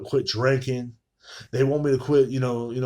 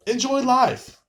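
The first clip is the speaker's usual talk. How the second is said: "enjoy life" floated much higher; lifted advice; 11 semitones higher, slower than usual. Real, not acted. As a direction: sing "enjoy life" up high and let it fall. Closing advice, said twice.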